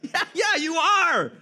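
high, loud joyful "you are"; excited affirmation. Real, not acted. Pastor answering the church with an excited shout. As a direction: lift it up and shout it back at them.

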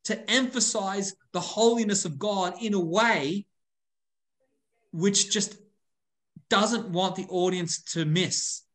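ordinary talk, nothing marked

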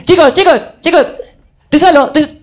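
put-on desperate kid shouting he's open; calling out for the ball. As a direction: call to teammates who ignore you.

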